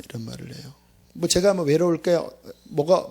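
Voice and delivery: ordinary talk, nothing marked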